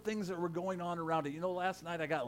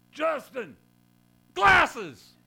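high, loud shout across the room; loud stage-director yell, much higher; 9 semitones higher, 14 dB louder, much slower than usual. Retold or imitated. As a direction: call out sharply to the actor onstage. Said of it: "a voice that yelled out".

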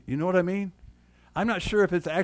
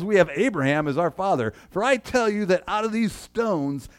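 higher, louder preaching of John's rebuke; forceful scripture reading; 5 semitones higher, 4 dB louder than usual. Acted. Pastor reading John the Baptist's rebuke to the crowd, forceful preaching. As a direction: thunder the prophet's warning.

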